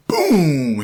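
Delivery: long explosive boom; booming impact. Retold or imitated. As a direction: hit it like a crashing wave. Another wave hits, retold.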